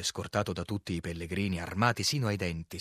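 ordinary delivery, nothing marked

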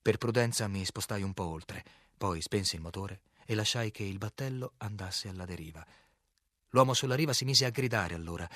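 ordinary delivery, nothing marked

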